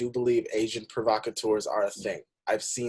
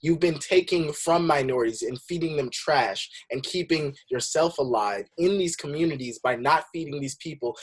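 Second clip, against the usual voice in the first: louder, higher, fired-up rebuke; indignant retort; 4 semitones higher, 4 dB louder than usual. Real, not acted. Answering a McDonald's ad that claims to care, indignant. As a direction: call the company out, voice raised.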